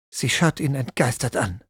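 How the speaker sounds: ordinary delivery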